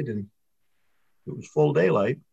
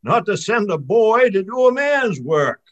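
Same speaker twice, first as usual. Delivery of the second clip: yelled taunt; 6 semitones higher, 7 dB louder than usual. A narrator's reading. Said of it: "yells Charlie".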